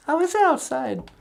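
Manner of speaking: very high, bright greeting; a cheerful high puppet hello; [name]'s puppet voice. Acted. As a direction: pitch way up for the puppet.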